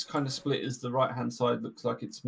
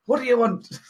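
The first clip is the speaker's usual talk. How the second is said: high, indignant snap of a startled dwarf; grumpy dwarf; 12 semitones higher, 5 dB louder than usual. Retold or imitated. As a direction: squeak it out, annoyed at being kicked. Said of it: "and he's like".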